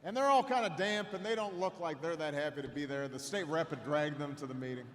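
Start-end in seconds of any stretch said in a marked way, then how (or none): none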